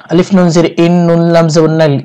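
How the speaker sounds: ordinary talk, nothing marked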